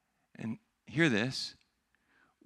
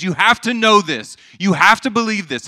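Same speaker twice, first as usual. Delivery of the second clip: loud, high, emphatic urging; urgent insistence; 8 semitones higher, 12 dB louder than usual. Acted. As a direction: drive it home as if grabbing them by the shoulders. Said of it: "God grabs us by the shoulders".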